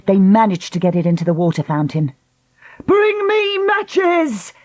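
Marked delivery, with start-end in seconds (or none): 2.9-4.5 high, slow ringing call; shouting for matches in triumph; drawn-out high demand; exultant cry. A narrator's reading. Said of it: "cried Lucy".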